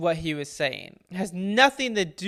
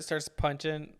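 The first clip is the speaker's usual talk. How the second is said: ordinary talk, nothing marked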